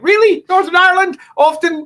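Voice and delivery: very high, loud disbelieving "Really?"; incredulous. Retold or imitated. Speaker retelling a political chat, high, incredulous squeal. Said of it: "and I was like".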